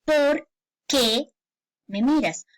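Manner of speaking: POR... QUÉ, two words with a gap; teacher's demo of a spaced "por qué"; separated words. Shown as a demonstration. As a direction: split "por qué", then pause before "me miras". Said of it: "haya ese espacio y suene correctamente".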